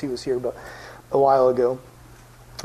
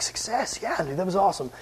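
hushed, higher-pitched cheer under the breath; hushed celebration; 3 semitones higher, 6 dB quieter than usual. Retold or imitated. Ricky sneaking out of the room after a small win, hushed celebration. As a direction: celebrate quietly so nobody hears.

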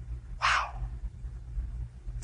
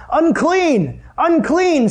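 calling out a warning; 9 semitones higher, much slower than usual. Retold or imitated. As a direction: call it out so strangers keep away. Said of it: "you'd have to call out".

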